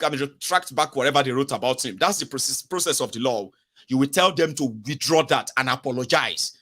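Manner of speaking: normal talking voice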